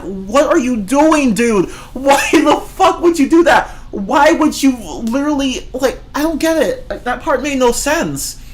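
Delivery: high, incredulous rant with a laugh in it; exasperated disbelief. Retold or imitated. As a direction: act baffled and fed up. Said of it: "And I'm just like".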